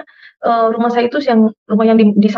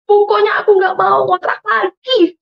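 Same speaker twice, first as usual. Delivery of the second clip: put-on high, slow childlike wail; retold tearful protest; 11 semitones higher, slower than usual. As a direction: insist like a crying child, re-enacted. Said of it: "Saya menangis di belakang kosan". (rengekan tangis yang ditirukan)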